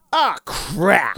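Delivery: put-on high, loud little-kid yelp of annoyance; little kid cursing. Four-year-old cousin at the drive-thru gets the wrong sauce, high loud kid yelp. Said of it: "And he goes".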